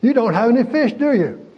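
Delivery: high, loud mocking jab at the fishermen; playful high needling question; teasing taunt. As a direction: rub it in with a grin. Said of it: "He said".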